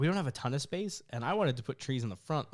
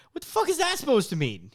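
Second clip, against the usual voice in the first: loud, high indignant demand; offended retort; 13 semitones higher, 7 dB louder than usual. Retold or imitated. Answering the coworker's greaseball jab, loud and offended. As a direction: push back hard, half laughing at it. Retells own offended reply.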